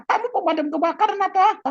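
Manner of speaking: a boss in disbelief at a cowardly fireman; scolding boss. Acted. Fire chief scolding scared firefighter, fast incredulous rebuke.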